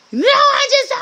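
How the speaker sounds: high whining cry, hammed up; mock the friend's wailing protest; a hammed-up wail of an upset friend; whiny mock wail. Mocking upset friend's reply.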